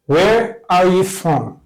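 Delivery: each word drawn out for learners; very slow model. Shown as a demonstration. Teacher modelling a phrase for students, very slow. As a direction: say it very slowly so students can copy. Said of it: "teacher models the question".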